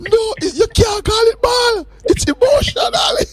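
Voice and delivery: high, slow shout through laughter. Real, not acted.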